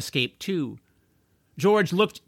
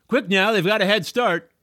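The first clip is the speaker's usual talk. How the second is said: loud, raised eager voice; smoker urging police; 6 semitones higher, 5 dB louder than usual. Acted. Smoker egging on the police, loud and eager. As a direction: hurry the coppers on. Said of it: "said one of the smokers".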